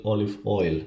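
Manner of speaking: usual speaking voice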